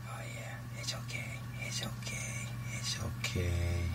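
soft whispered reassurance, slowing at the end; soothing whisper. Real, not acted. Owner calming her scared dragon with a soft whisper. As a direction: hush the frightened animal gently.

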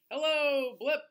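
ordinary delivery, nothing marked

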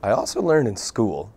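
ordinary talk, nothing marked

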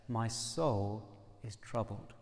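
low, slow, soft lament; troubled soul. Acted. Jesus just before the cross, quietly troubled. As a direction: say it heavily and quietly.

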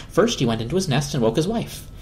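ordinary delivery, nothing marked

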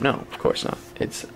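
whispered sarcastic aside. Real, not acted. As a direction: breathe it out like a guilty joke. Sarcastic aside about game timing.

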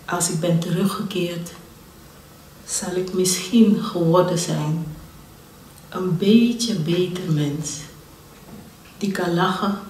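measured poetry reading with long pauses between phrases; unhurried spoken verse with dramatic swells; poem recitation. Acted. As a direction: recite the poem deliberately, lingering on key words, swelling then easing.

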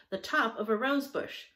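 usual speaking voice

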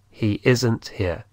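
He isn't here said slowly, isn't stressed; slow it down for learners; slow model. Shown as a demonstration. Teacher repeats slowly for students to copy. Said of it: "¿cómo sería?".

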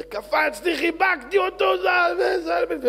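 high, loud rush of joy; loud, high-pitched exclamation; excited cry. Acted. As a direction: shout the name in elation, held high. Retold excited leap and embrace.

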